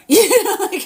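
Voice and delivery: bursts out laughing high. Real, not acted. Laughing at her own point to viewers. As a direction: laugh through the words.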